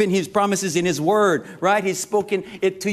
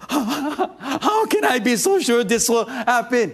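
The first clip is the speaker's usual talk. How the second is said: high, slow, trembling-fearful Zechariah; scared old priest; 7 semitones higher, slower than usual. Retold or imitated. Pastor acts out Zechariah's fear to the angel, scared and slow. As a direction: play him frightened and doubtful. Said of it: "he said it like this".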